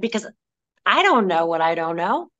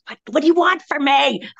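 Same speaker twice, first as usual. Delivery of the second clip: exasperated plea; 7 semitones higher, 4 dB louder than usual. Retold or imitated. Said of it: "I'm like".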